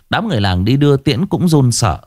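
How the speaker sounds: plain reading voice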